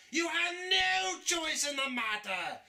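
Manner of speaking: high booming villain shout; villainous shout. Acted. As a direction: roar it down at him. Villain asserts power.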